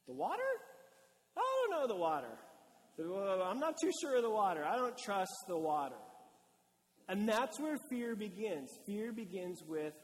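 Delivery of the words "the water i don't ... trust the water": hammed-up childlike misgiving; doubtful child. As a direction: balk at the water like a small child. Voices his kids doubting the water.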